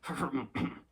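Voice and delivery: awkward throat-clearing; awkward cough. A narrator's reading. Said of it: "turning it awkwardly into a cough".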